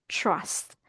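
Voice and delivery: "Trust" as "chrust", low and soft; "Trust" softly falling, chr onset; correct TR sound. Shown as a demonstration. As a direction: say "Trust" quietly with a CH-like TR. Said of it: "Ito ay trust".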